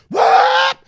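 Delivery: high loud whoop as the car takes off; exclaiming as the car speeds away; sudden whoop. Retold or imitated. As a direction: yelp the take-off. Car slams into gear.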